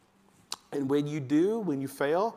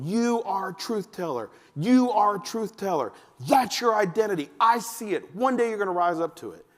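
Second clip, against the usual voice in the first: building declaration; 5 semitones higher, 5 dB louder than usual. Retold or imitated. Repeated declaration face to face.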